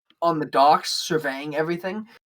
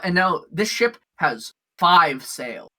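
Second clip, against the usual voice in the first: usual speaking voice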